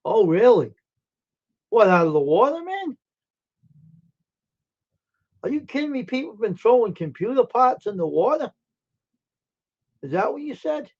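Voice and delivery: high-pitched, incredulous questions; real disbelief. Real, not acted. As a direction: react in genuine surprise, voice jumping up. Reacting to news of dumping.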